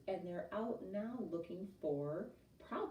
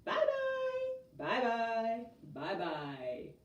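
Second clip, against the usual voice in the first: three bears calling goodbye; 5 semitones higher, 4 dB louder, much slower than usual. A narrator's reading. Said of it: "big, gruff voice, a middle-sized voice, and a high, squeaky voice".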